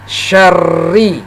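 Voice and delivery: SYARRI with a long, pressed double r; doubled, pressed r. Shown as a demonstration. Teacher demonstrating tasdid to learners, pressed double r in syarri. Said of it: "membacanya ditekan suara kita".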